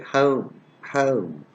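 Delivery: "home" with a wide ow glide, like "howm"; textbook pronunciation. Shown as a demonstration. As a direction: open the vowel of "home" into a diphthong. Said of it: "We usually don't say that".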